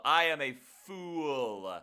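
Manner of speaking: slow, loud hammed-up wail; dramatic lament. Real, not acted. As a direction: moan it like a tragedy.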